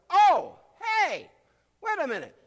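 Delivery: high, loud excited cry; light up as if the answer just hit; sudden realization. Retold or imitated. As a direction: disciples in the storm, suddenly excited. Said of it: "they did figure out".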